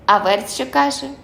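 plain reading voice

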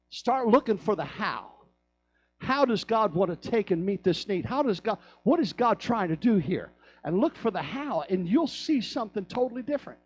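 loud, high, fervent preaching; rising hellfire sermon voice; preaching at full force. Acted. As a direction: lift the room, full throated.

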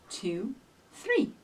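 plain reading voice

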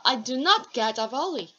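slow model sentence, hit not; teacher drilling the negative, stressing not. Shown as a demonstration. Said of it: "이 위치가 너무 중요합니다".

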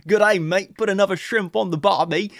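loud, high hammed-up Aussie voice; over-the-top Australian accent; mock Australian accent. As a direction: play the cartoon Australian with a grin. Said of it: "Shout out to my Australian brothers".

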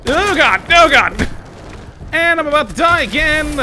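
high, loud frantic cries; panicked yelling. Real, not acted. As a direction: scream in panic at dying. Losing fight, about to die.

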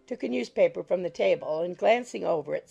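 usual speaking voice

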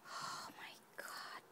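barely voiced, very soft amazement; awed whisper. Real, not acted. Heart stopped at the gorgeous gift, awed whisper. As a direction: whisper it, stunned.

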